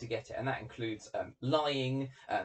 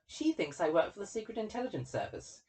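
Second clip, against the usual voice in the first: Elliot answering interviewer; 7 semitones higher than usual. Acted. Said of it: "And he says".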